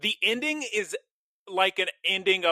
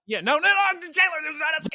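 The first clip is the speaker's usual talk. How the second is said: higher, louder "no, no, no" correction; laughing correction; 11 semitones higher, 3 dB louder, faster than usual. Real, not acted. Correcting Taylor's mishearing, high laughing shout. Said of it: "The, the scam guy?".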